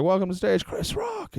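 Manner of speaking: high-pitched MC introduction; mock stage intro, soaring high; excited stage announcer.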